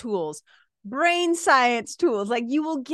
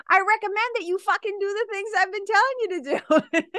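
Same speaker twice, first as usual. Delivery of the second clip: mock blunt outburst, much higher; exasperated snap; 9 semitones higher than usual. Coach pretends to tell a client off, high and exasperated. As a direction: blurt the swear in fake frustration. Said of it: "of course I don't say it like that".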